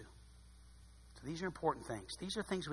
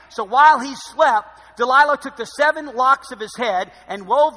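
high, loud, emphatic sermon voice; full-force preaching; 9 semitones higher, 19 dB louder than usual. Real, not acted. Pastor preaching on Samson to the congregation, full force. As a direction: preach it to the back row.

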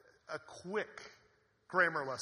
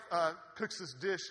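normal talking voice

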